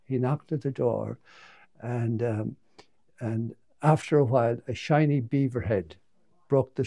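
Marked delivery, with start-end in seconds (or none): none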